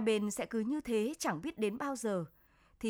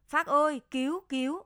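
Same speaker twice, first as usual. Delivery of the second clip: loud, higher panicked call for help; loud cry for help; 5 semitones higher, 6 dB louder than usual. Acted. Stuck in the fast current, he shouts to Phác for help. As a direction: yell for help from the rushing stream. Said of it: "tiếng anh nhỡ kêu to".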